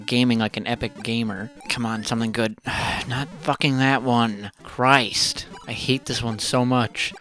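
usual speaking voice

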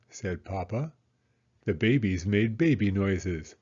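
ordinary delivery, nothing marked

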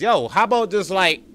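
normal talking voice

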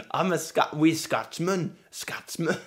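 attempted Scottish accent, breaking up. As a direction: try a broad Scots accent. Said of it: "Right, Scottish".

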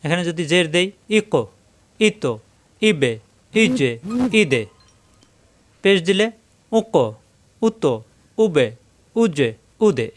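ইকো উকো said with a knock on the last letter; bouncing qalqala. Shown as a demonstration. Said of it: "এভাবে ধাক্কা হবে".

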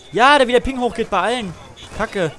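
high, loud, annoyed; exasperated outburst. Real, not acted. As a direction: vent about the lag. Complaining about lag.

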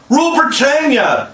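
very high, loud patriotic squeak; small boy's high patriotic squeal. A narrator's reading. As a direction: squeak it out bravely. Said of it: "squeaked Curly".

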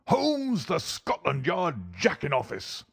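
slow, high, furious taunt; angry old doctor. Acted. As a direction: draw the insult out high and scornful.

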